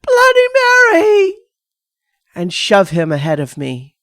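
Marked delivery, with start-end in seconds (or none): 0.0-1.3 very high, loud shriek. A narrator's reading. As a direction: shriek it while grabbing him.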